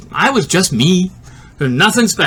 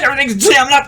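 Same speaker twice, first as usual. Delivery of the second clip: ordinary delivery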